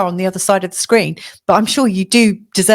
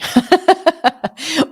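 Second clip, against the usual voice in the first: light laugh; 9 semitones higher than usual. Real, not acted. Laughs after the aside.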